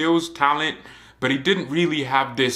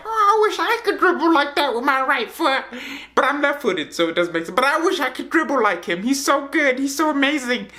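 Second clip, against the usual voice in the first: much higher put-on voice, mock-envious and whiny; mock Messi voice; 14 semitones higher than usual. Messi envying a young dribbler, in a high mock voice. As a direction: play Messi as a jealous, wide-eyed fan, pitched way up. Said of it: "Even though Messi doesn't sound like that".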